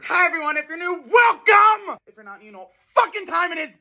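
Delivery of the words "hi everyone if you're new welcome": welcome shout. Acted. Opening the Daily Scream show.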